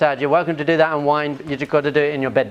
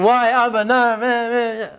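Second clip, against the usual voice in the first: high-pitched mock whine; whiny kid pleading with a parent; whining child; 9 semitones higher than usual. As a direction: imitate a small daughter's wordless whining. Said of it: "She carried on whining".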